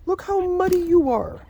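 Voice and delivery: high sing-song voice for the chickens; high pet-talk scolding; pet baby talk. Acted. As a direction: coo at the muddy bird like a baby.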